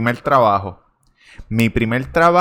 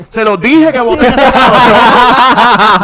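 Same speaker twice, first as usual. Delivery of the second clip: high, slow laughing mock voice; hammed-up gloating soldier through laughter; mock soldier rant; 10 semitones higher, 10 dB louder, much slower than usual. Podcast host voicing a soldier gloating about the vote, laughing and slow.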